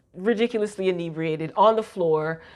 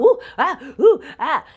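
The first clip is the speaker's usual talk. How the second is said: high, loud little panting exclamations; hammed-up lusty yelps, pitched up; mock lustful oohs and ahs; 6 semitones higher, 4 dB louder than usual. Recapper mimicking the co-worker's lust jumping out, mock lustful oohs. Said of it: "his lust starts jumping out".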